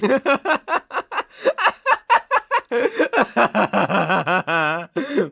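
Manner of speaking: long, loud, high cackle; maniacal laughter. Acted. Captor loses control over his captive, maniacal laughter. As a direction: laugh helplessly, unhinged.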